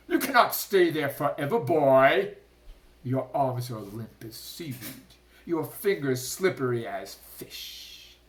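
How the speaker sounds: jeering shark; loud gloating taunt. A narrator's reading. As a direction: savor his helplessness. Shark taunts dangling Punia.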